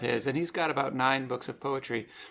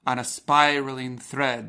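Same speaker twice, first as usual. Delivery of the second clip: slow, louder reading that punches each beat; strong beats read; 5 dB louder than usual. Shown as a demonstration. Host reads the poem line to listeners, slow beats stressed. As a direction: read it slowly and hammer the two strong beats. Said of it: "There's two beats".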